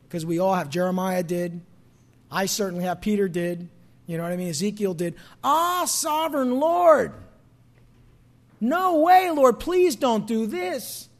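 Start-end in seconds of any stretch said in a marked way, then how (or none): 5.4-11.1 high, loud, slow anguished plea; crying out. Retold or imitated. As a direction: cry out begging God to stop. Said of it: "cried out in a loud voice".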